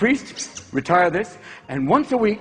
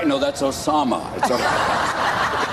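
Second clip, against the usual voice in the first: lower mock Bush drawl; 7 semitones lower, much slower than usual. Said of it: "W keeps going".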